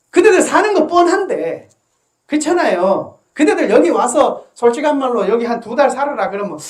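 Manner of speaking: monk scolding his listeners, loud preaching; fiery preaching. Acted. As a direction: drive it home to the congregation. Preaching at full force.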